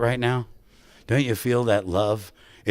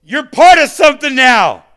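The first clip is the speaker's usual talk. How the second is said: much higher, louder call from far away; yelling across lot; 14 dB louder than usual. Retold or imitated. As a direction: yell it across the parking lot. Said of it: "He yells".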